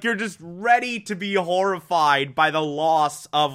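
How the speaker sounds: ordinary talk, nothing marked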